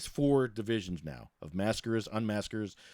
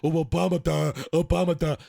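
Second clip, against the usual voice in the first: higher, louder mocking imitation; mock their gushing, dopey tone; mocking fan; 6 semitones higher, 7 dB louder than usual. Mocking people who gush over Obama, louder mock voice. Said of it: "So when you say".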